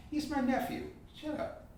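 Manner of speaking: high, quiet mock brush-off; dismissive brush-off. Quoting himself shooing off a nosy questioner, high and quiet. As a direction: wave the question away with a quiet, high little shut-up.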